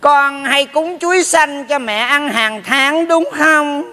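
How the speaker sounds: slow, drawn-out motherly voice; pretend mother. Neighbour posing as the man's mother questions his offerings, pretend mother. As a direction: play a nagging mother, words stretched out. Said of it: "Tao giả mẹ mày".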